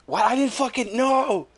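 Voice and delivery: high-pitched cry of protest; indignant outburst. Retold or imitated. Telling a friend about spoilers, indignant high outburst.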